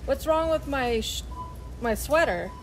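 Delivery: ordinary talk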